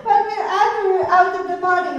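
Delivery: repeating the answer through laughter; chuckling it back, pitch rising high; laughing. Real, not acted. Teacher laughing at a student's answer, laughing.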